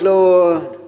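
usual speaking voice

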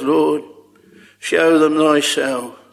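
usual speaking voice

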